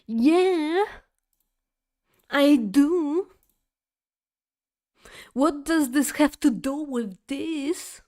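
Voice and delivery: high, loud mocking of a smug shopper; smug woman. The mother answering her smugly, a high mock voice. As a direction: play her as smug and dismissive. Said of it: "looking smug at me".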